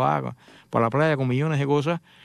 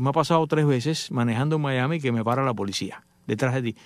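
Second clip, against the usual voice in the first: ordinary delivery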